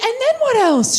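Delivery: high, slow, cooing; eager fiance. Retold or imitated. Fiance egging Courtney on, high and cooing. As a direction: coo encouragingly.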